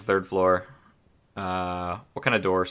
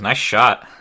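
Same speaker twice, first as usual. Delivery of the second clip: loud in-character yell upward; calling up; 7 dB louder than usual. Acted. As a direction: call it up to the shooter, louder. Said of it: "he yells up and he goes".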